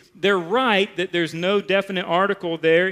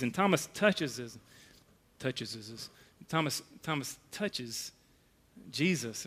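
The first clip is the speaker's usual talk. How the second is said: dropping almost to a whisper; hushed awe; 6 semitones lower, 12 dB quieter than usual. Real, not acted. Pastor telling Thomas touching Jesus, hushed.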